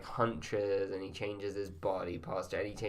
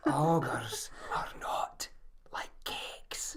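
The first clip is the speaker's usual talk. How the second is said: slow, whispered Shrek voice; 6 semitones higher, 3 dB quieter, much slower than usual. Shown as a demonstration. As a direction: breathe it out like Shrek. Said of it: "That was pretty good".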